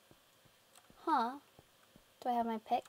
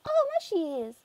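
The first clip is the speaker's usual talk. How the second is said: ordinary talk, nothing marked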